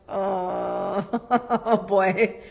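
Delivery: put-on chuckling oh boy with a laugh; amused laugh. Therapist to couple with no answer, amused laughing sigh. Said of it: "I'm like".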